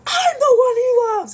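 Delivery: high, loud mock wail; crashing out. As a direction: wail it slowly like a hammed-up meltdown. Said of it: "Calypso crashes out".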